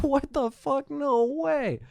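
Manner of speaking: very high-pitched, incredulous squeal; shocked disbelief. Retold or imitated. Storyteller replaying seeing the murder suspect on the news, shocked disbelief. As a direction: let the voice leap up, astounded. Shocked on learning screamer is killer.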